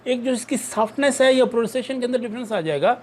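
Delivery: ordinary talk, nothing marked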